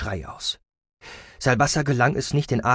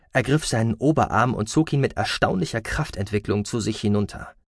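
ordinary delivery, nothing marked

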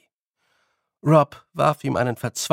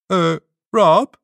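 loud high dopey fairy; dim Feegle; 5 semitones higher, 8 dB louder than usual. A narrator's reading. Wully asking Rob, loud dim Feegle. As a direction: call out slow-witted.

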